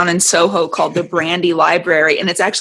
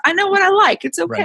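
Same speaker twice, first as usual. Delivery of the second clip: much higher, breezy and self-assured; high defiant quote; 13 semitones higher, faster than usual. Retold or imitated. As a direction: toss it off high and carefree. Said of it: "And I'm like".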